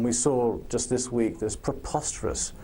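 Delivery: ordinary talk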